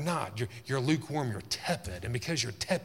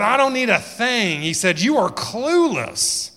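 much higher and louder, slow and exaggerated; loud mocking boast; 10 semitones higher, 10 dB louder, slower than usual. Preacher mocking the Laodiceans' boast, loud and high. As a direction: ham up their bragging. Jesus rebuking the boasting church.

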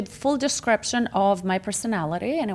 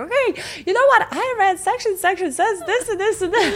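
high, quick, bursting with self-pleased excitement; eager know-it-all; 11 semitones higher, faster than usual. Retold or imitated. Calling her attorney to show off her reading, high eager chatter. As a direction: rattle off the law section like a proud student. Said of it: "it's like".

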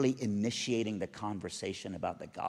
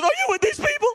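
imitated wailing, very high and loud; tearful outburst; 10 dB louder, faster than usual. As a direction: burst like a broken dam, hammed-up. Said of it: "Like the dam just broke".

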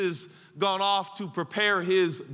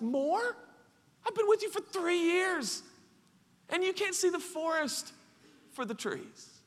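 high, softened incredulous voice; pained disbelief; 10 semitones higher, 5 dB quieter than usual. Acted. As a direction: plead in wounded amazement. Voices Jesus incredulous at Philip.

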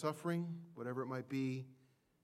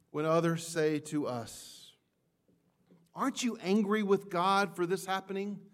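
louder and higher, building to a passionate cry; impassioned sermon peak; preaching at full force; 7 semitones higher, 9 dB louder than usual. Acted. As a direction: preach it out, swelling with each phrase.

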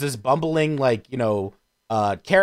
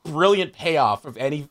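ordinary delivery

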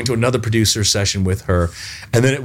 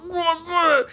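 mock pain cries. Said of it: "Now that's how you do pain".

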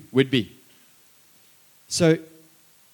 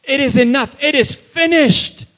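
declared finished; 11 semitones higher, 10 dB louder than usual. Acted. Father's reply, building.

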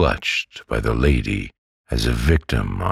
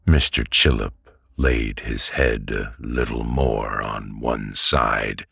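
usual speaking voice

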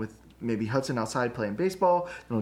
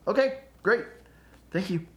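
cheerful acceptance; 7 semitones higher than usual. Pretend. Ready to accept wife's errand.

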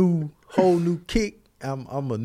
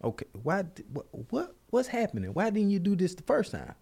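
hushed disbelief; 5 semitones higher, 8 dB quieter, faster than usual. Retold or imitated. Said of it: "And I'm like".

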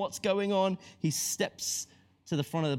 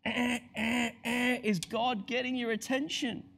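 higher buzzing honk of a horn; Andy Hawthorne's klaxon; 7 semitones higher than usual. Shown as a demonstration. Preacher to congregation, mock klaxon honks. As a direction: blare it like an alarm. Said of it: "my very Andy Hawthorne impression of a klaxon".

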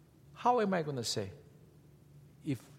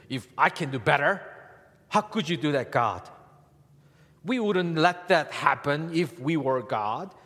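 louder grumbling challenge to God; mock complaint; 3 semitones higher, 7 dB louder than usual. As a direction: play the know-it-all grumbler. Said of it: "That's what we say".

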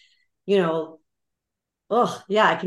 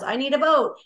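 mock wanting more; 8 semitones higher than usual. Mock greed.

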